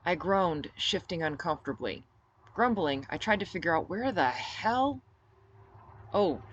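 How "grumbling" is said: usual speaking voice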